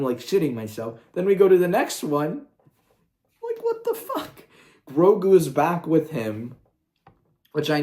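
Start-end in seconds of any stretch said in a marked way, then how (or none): 3.4-4.4 high, laughing what the fuck; incredulous laughing squeal; laughing disbelief. Real, not acted. Laughing disbelief at bad episode.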